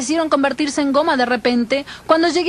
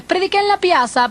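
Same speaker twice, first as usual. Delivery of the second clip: ordinary delivery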